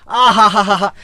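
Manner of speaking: loud gushing sigh of admiration; exaggerated, sarcastic oohing and aahing; mock swooning "aha-ha". Mock admiration of English-speaking students. (बनावटी वाह-वाही में ज़ोर की आहें)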